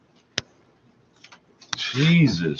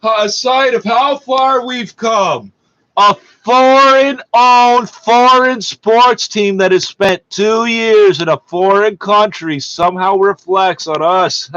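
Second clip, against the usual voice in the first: slow, loud, higher sarcastic yell; mocking outraged shout; 4 semitones higher, 4 dB louder, slower than usual. Real, not acted. Host yelling back at the radio reporter, slow mocking shout. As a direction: throw the reporter's words back at him in disgust.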